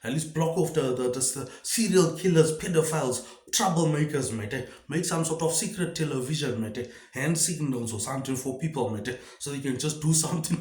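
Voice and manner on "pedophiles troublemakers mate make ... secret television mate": ordinary talk, nothing marked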